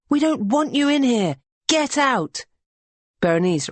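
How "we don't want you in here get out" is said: high, commanding shout. A narrator's reading. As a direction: shout at the men. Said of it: "Amara shouts".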